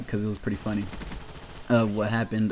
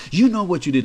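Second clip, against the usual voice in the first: higher, louder stern accusation; accusing chaperone; 8 semitones higher, 7 dB louder than usual. Retold or imitated. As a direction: scold him like a guilty kid.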